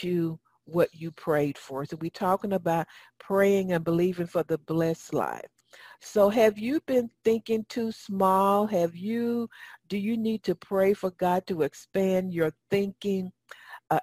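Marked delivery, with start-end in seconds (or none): none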